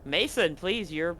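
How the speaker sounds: ordinary delivery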